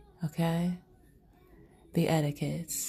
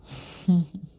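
short laugh; 2 semitones higher, 5 dB louder than usual. Real, not acted. Laughs after cheeky line.

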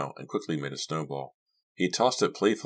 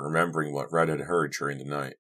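ordinary delivery, nothing marked

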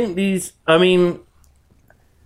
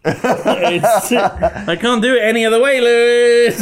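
laughing; 7 semitones higher, 4 dB louder than usual. Real, not acted. Laughing through the refusal.

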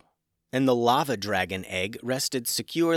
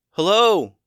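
loud calling shout, higher; yelling; 9 semitones higher, 10 dB louder than usual. A narrator's reading. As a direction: call out across the burning town. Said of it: "yelled Max".

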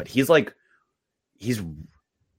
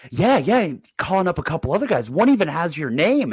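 hyped dad; 11 semitones higher, 3 dB louder than usual. Retold or imitated. Dad hyping kid with news.

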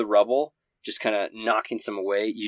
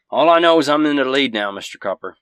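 higher, louder and fast, cocky taunt; calling back; 5 semitones higher, 10 dB louder, faster than usual. Acted. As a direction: brag across the fight. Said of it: "he calls back and he says".

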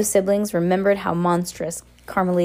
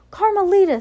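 put-on high, louder baby's cry; 13 semitones higher, 5 dB louder than usual. A narrator's reading. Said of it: "Sunny cried".

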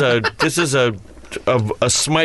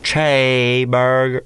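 slow, lower Southern drawl; 4 semitones lower, slower than usual. Shown as a demonstration. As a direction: drag it out in a low Southern accent. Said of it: "drawl it".

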